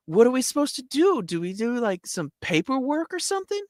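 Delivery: high, puzzled falsetto; confused soldier. Retold or imitated. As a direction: ask it high and baffled. Confused soldier asks sergeant.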